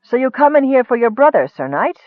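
plain reading voice

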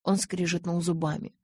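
ordinary delivery

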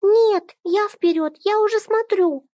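put-on high stubborn child voice; clutching it, refusing to give in; little girl voice. A narrator's reading. Child defends her toy.

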